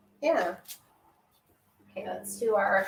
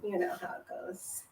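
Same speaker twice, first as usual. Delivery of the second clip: whisper; 10 dB quieter than usual. Real, not acted. Aside whispered to viewers.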